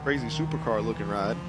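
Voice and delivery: talking through a yawn. Real, not acted. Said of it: "Oh, see, I'm yawning".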